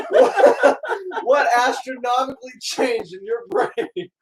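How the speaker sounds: talking through laughter; laughing. Real, not acted. Teasing parent, can't stop laughing.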